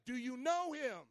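high-pitched, pressing preacher questions; fired-up preaching. Real, not acted. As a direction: press each question on the congregation. Preaches at full force.

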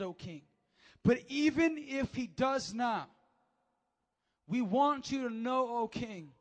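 defiant sermon delivery of the reply; bold preaching declaration. Shown as a demonstration. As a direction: proclaim it like a bold comeback to a king. Comeback for the ages.

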